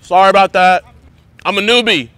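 high, loud call across distance; shouted apology. Real, not acted. Newbie apologizing to annoyed golfers, loudly called out. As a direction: holler it over to strangers.

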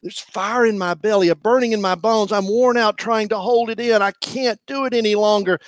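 high, loud, anguished outcry; anguished shouting complaint. Acted. Jeremiah shouting his complaint to God, anguished.

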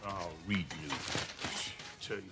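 put-on grumpy old man.

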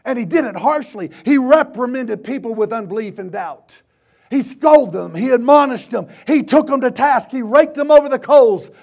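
loud, high-pitched preaching; fired-up pulpit delivery; forceful preaching. Real, not acted. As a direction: hammer each phrase out at full force.

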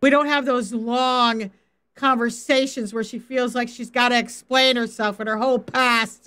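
normal talking voice

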